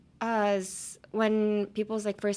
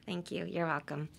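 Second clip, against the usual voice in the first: ordinary talk, nothing marked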